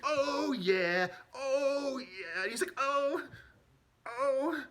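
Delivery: happy shower moans. Retold or imitated. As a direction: moan with glee under the water. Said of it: "singing in the shower".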